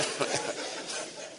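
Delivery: quiet unvoiced chuckle; soft laugh. Real, not acted. Pastor chuckling to the congregation, quiet breathy laugh. Laughs after joke about God.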